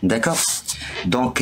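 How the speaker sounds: ordinary talk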